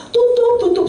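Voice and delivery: high, insistent "tutup, tutup"; urging friend. Retold or imitated. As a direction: imitate the friend pushing him to shut down. Friend urging to close shop. (teman mendesak, nada tinggi)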